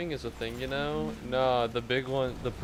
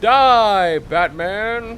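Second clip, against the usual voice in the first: much louder, higher mock villain cry; villain shout; 8 semitones higher, 15 dB louder, much slower than usual. Streamer attacking Batman in game, loud villain shout. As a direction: yell it like a cartoon villain.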